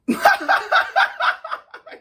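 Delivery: high loud cackle; burst of laughter. Real, not acted. Laughing at own joke, loud high cackle. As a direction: crack up loudly.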